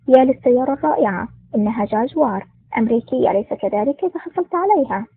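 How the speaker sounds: ordinary delivery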